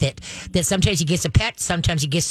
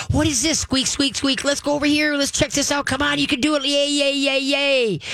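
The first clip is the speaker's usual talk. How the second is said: much higher, bouncy cheering for the dog; cheerleader; 7 semitones higher than usual. Shown as a demonstration. Trainer showing the owner how to cheer a dog past a trigger, high and excited. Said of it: "become the cheerleader".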